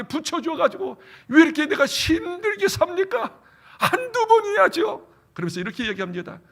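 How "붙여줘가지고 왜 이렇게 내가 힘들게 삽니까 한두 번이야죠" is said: rising, pleading whine, slow at the end; whining lament. Retold or imitated. Moses complaining to God, high pleading whine. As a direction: moan to God about the people.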